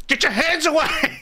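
high-pitched comic protest; mock panic. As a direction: squeal it through laughter, fending someone off. Acts out fending off the physio.

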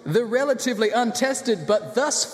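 high, slow, building arena introduction; ring announcer. Acted. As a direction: stretch the fighter's name into a final shout.

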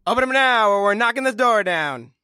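police threat. Acted. Police officer threatens detectives behind a door, commanding call.

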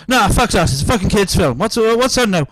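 dad shouting about the film choice; angry yell. Retold or imitated. Angry customer dad voiced.

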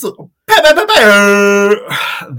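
high, slow "pew pew" zaps; laser sound effects. Acted. Co-host adding zaps after the intro, high and loud. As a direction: fire off cartoon laser noises.

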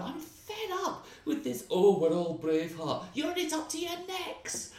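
comic rant at the audience, pitched far up; ranting impression. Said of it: "and I went".

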